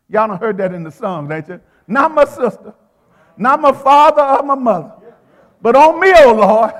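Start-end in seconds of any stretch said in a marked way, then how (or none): 2.1-6.7 preaching. Real, not acted. Preacher at full force.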